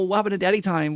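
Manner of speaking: usual speaking voice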